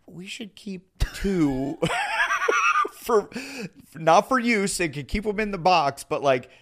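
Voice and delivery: sheepish plea. Retold or imitated. Husband asking his wife to keep two, sheepish pleading.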